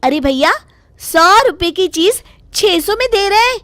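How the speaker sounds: the wife refuses to be fooled; shocked haggling wife. Acted. Sudha haggling, shocked at price. (भाव सुनकर चौंकी, ऊँची तकरार)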